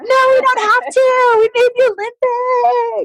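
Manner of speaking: very high, loud, tearful joy; celebrate, refuse to be moved; overjoyed teary cry; ecstatic shout. Retold or imitated. Swimmers refusing to leave pool.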